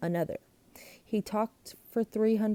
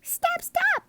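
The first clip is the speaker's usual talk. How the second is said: high breathless scream; 5 dB louder than usual. A narrator's reading. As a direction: screech it frantic. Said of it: "my sister screamed".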